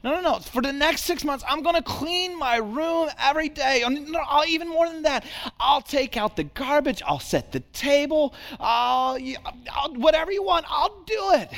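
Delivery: put-on high, quick, eager kid voice; a six-year-old rushing to make amends; eager little boy. Son voiced in the story.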